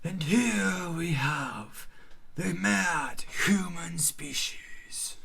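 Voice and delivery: hushed, slow nature-show whisper; documentary narrator. Pretend. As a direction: whisper like a wildlife presenter.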